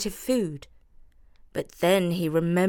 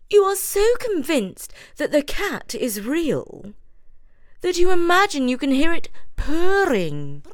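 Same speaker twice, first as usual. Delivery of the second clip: high, matter-of-fact mouse voice; Judith explaining; 9 semitones higher, 5 dB louder than usual. A narrator's reading. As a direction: lecture the scared mice. Judith continues.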